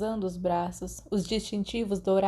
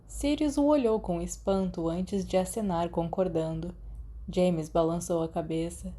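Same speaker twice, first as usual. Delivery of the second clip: ordinary delivery, nothing marked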